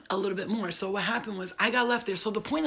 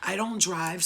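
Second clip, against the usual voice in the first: ordinary talk, nothing marked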